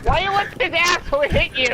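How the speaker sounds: high exasperated yell; raging gamer. Real, not acted. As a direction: snap at your teammate. Frustrated at teammate.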